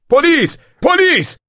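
very high, loud cry; high-pitched alarmed shout; shouting for police. A narrator's reading. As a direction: yell out for the police. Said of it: "جوان فریاد زد".

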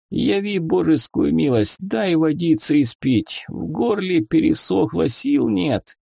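high, weak pleading of a wounded soldier; plaintive, high-voiced suffering; pitiful lamenting. A narrator's reading. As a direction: beg pitifully for mercy. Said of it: "продолжал причитать солдат".

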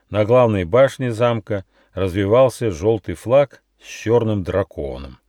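usual speaking voice

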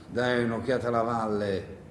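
ordinary delivery

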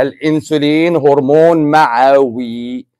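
slow, deliberate dictation for memorizing. Shown as a demonstration. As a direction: spell it out slowly for students. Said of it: "احفظ الكلمه دي ورايا".

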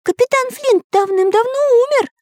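very high-pitched objection; squeaky cartoon hero. A narrator's reading. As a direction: protest in a squeaky little voice.

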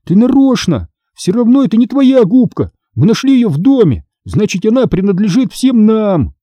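put-on high, whiny boy's protest; heated childish arguing; squabbling accusation. A narrator's reading. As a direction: accuse your sister and argue your share. Boy accusing sister in quarrel.

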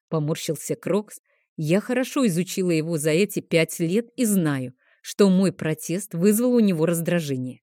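plain reading voice

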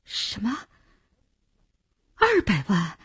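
stunned, hushed question; taken aback, barely believing it; astonished. A narrator's reading. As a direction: caught off guard by startling news. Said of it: "惊住了".

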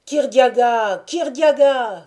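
high, loud chant of a name; crowd shout. A narrator's reading. Supporters acclaiming Kirdiaga, shouting. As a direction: cry the name out at the top of the crowd. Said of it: "s'écrièrent-ils".

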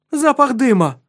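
high, loud alarmed shout; alarmed cry. Acted. Countess smells smoke, alarmed.

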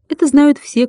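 plain reading voice

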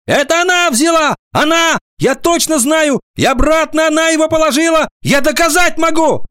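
put-on very high, frantic boy's accusation; agitated high schoolboy outcry; shouting. A narrator's reading. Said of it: "закричал Алик".

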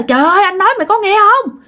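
high-pitched, fast retold scolding; urgent scolding yell. Quang yelling at the frozen kid to fetch adults, urgent scolding. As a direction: yell it urgently, high and rushed, as the older boy. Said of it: "anh Quang mới la bạn".

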